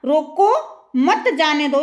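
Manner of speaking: drawn-out "रोको", break, "मत जाने दो" as one phrase; pause after रोको. Shown as a demonstration. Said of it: "इसको रोक लो, जाने मत दो".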